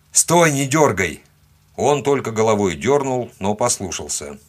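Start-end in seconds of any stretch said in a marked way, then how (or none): 0.1-1.2 loud, higher, slow order; shouted command. A narrator's reading. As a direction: call out an urgent order. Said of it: "крикнул я". (громкий командный окрик)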